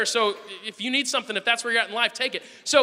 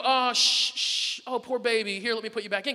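soothing parent. Acted. Father mimics coddling a screaming child, soothing. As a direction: hush and coddle a crying baby. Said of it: "we don't pick her up and go".

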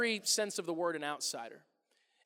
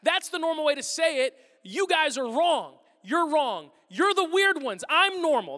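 loud, high, exasperated rant; raised mock-indignant voice; indignant outburst; 7 semitones higher, 7 dB louder, slower than usual. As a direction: insist loudly that they are the weird ones. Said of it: "And I'm like".

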